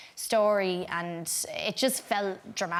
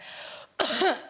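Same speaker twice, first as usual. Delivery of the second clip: imitated nervous horse cough, higher; horse's nervous cough; 8 semitones higher than usual. Mimicking the horse's cough before rolling, imitated nervous cough. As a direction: fake a little nervous cough. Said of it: "a nervous cough that he would do".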